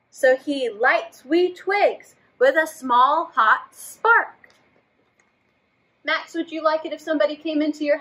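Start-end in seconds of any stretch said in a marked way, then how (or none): 0.1-4.5 slow, expressive storybook reading; reading to children. A narrator's reading. Teacher reading a story to children. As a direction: draw the kids into the story. Reads picture book aloud.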